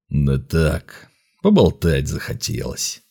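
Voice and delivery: low, rough drawl of a smoker; gruff smoky wolf voice. Acted. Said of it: "протянул волк грубым прокуренным голосом".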